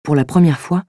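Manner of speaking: ordinary delivery, nothing marked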